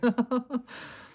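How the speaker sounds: high helpless giggle; real laughter. Real, not acted. Reader amused by the viewer seeming scary, high laugh. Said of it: "I don't know why I'm laughing".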